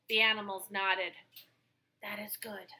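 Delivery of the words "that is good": hushed whisper; whispered agreement. Acted. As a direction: whisper it in relief. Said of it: "they agreed".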